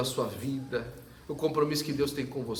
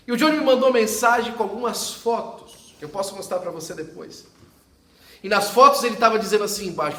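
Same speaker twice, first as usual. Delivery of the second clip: preaching at full force; 8 semitones higher, 11 dB louder than usual. Acted. Pastor preaching to the congregation, loud and rising. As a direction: proclaim it to the whole church.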